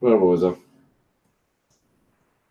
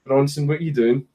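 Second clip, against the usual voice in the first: ordinary talk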